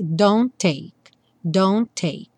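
don't take with a single T; one T only. Shown as a demonstration. Teacher shows don't take with one T. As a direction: merge the two T's of don't take. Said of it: "só um T aparece".

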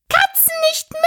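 very high screeching mouse voice; screeching, furious. A narrator's reading. Offended mouse to Alice, screeching and furious. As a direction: squeal it in outraged fury. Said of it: "schrie die Maus mit kreischender, wütender Stimme".

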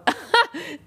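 short, high burst of laughter; real giggle; amused laugh. Real, not acted. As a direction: laugh at your own silly mistake. Laughs at own brand-name slip.

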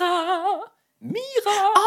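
high, ringing call across the house. Acted. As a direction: call her name from a distance. Kopernikus calling Mira from afar.

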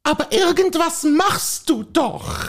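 nagging wife. Acted. Wife nagging her husband to go for a walk, fussy. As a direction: play the nagging wife in a comic sketch. Acted dialogue sketch, wife role.

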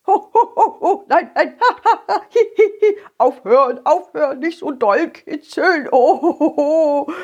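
high helpless giggling; laughing while tickled. Acted. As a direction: laugh and beg to stop. Path being tickled.

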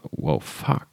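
leise, fast tonlos gehaucht; betroffen gedacht. Acted. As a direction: wie ein geschockter Gedanke, kaum Stimme. Gespielter Gedanke nach einer Schocknachricht. (leise, tonlos vor Betroffenheit)

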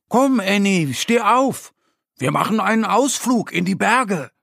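high, cheerful storybook bear voice; bear Mo calling. A narrator's reading. Mo the bear wakes Annie for a mountain trip, high and cheerful. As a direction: wake your friend up brightly. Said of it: "die Stimme von ihrem Freund Mo".

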